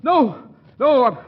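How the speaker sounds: high, loud frantic nos; desperate escape, voice way up; panicked refusal. Acted. Hero panics, flees through window.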